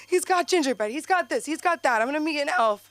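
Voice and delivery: high, gushing excitement; excited. Retold or imitated. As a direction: list it like a giddy kid. Excited list about Santa.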